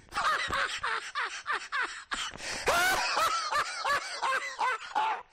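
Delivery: long, high cackle of scorn; exaggerated high-pitched mocking laughter. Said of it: "God's gonna mock at them. Laugh at them".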